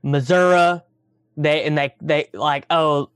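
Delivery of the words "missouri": "Missouri" said Missour-uh, ending on an uh sound, in a hill-country voice. Shown as a demonstration. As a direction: say "Missouri" like the hill folk do, final syllable as uh. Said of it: "people from the hills out here who say".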